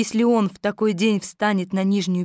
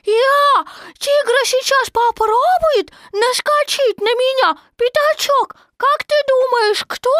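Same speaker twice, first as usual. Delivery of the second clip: very high, loud, drawn-out excitement; over-excited tiny kangaroo; fervent little Roo; 5 dB louder than usual. A narrator's reading. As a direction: chatter on eagerly in a squeaky voice. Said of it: "с жаром заявил крошка Ру".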